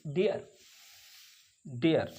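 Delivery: डियर gliding ee into uh; ear sound. Shown as a demonstration. Teacher drilling the ear vowel. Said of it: "ई और आ की आवाज़".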